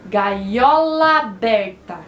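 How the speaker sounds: "Gaiola aberta" drawn out slowly, the two A's merged into one; "Gaiola aberta" as one slow, linked word; words run together. Shown as a demonstration. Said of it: "Viu como a gente fala junto?".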